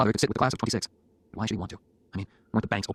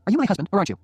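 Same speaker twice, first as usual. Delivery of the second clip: high, louder challenge; teasing wife; 10 semitones higher, 6 dB louder than usual. Acted. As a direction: dare your husband playfully. Jenny teasingly challenges new husband.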